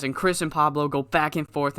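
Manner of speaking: usual speaking voice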